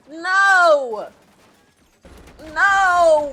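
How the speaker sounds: hammed-up loud cries of no; mock anguished no. Viewer mourning Jesse's death in a hammed-up shout. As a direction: wail at the screen in mock despair.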